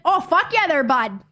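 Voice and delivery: hammed-up Canadian, loud lift on the opening; Canadian accent. Shown as a demonstration. Streamer showing off the accent asked about, in exaggerated Canadian. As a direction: put on a hearty Canadian accent. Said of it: "Oh, my Canadian accent?".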